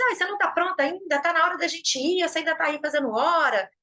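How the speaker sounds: mock irritated partner; impatient nagging. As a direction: rush them, annoyed. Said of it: "a pessoa assim".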